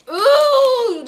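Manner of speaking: ordinary talk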